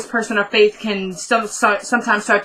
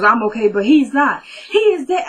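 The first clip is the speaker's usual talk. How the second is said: imitating loud crying; 3 semitones higher than usual. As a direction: replay her breaking down, pitch jumping up. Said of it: "'Cause now she's crying really loud".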